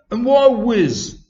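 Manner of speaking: high, loud, drawn-out question; annoying schoolboy. A narrator's reading. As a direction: blare it out like a pesky boy in the front row. Large greedy boy pipes up in class.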